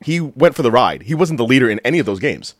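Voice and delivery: ordinary talk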